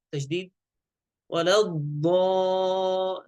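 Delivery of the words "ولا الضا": "الضا-" held long on heavy aa; sustained chanted ḍaa in "ولا الضا-"; six-count madd. Shown as a demonstration. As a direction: stretch the vowel of "الضا-" slowly. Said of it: "ست حركات".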